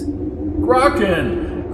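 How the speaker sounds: high call over the wind; crying out. Acted. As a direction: call after him into the dark. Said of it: "cried Antovian into the wind".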